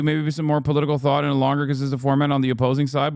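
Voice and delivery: ordinary talk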